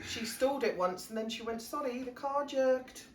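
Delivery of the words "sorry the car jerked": put-on Welsh accent. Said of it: "Welsh, that, I can't do accents".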